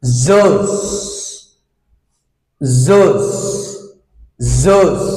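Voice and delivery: Zos said with a buzzing Z sound, low; Z sound demo. Shown as a demonstration. Teacher models the phonics sound for students, slowly. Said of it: "right pronunciation".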